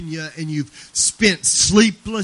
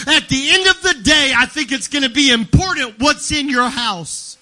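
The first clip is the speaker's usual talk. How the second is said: loud, high, driving preacher's cry; full-force preaching; 4 semitones higher, 6 dB louder than usual. Acted. Preacher pressing the congregation, shouting loud and high. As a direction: preach it hard to the whole church.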